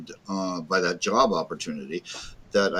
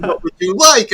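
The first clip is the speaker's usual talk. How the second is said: high, loud, laughing quote; laughing secretary voice; 12 semitones higher, 9 dB louder than usual. Retold or imitated. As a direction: quote her through laughter.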